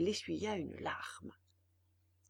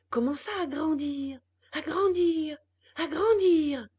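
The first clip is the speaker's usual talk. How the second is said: building excitement; 6 semitones higher, 6 dB louder than usual. A narrator's reading. Nose growing, repeated build.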